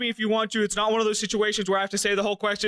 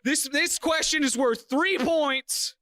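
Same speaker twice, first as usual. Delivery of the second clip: ordinary talk, nothing marked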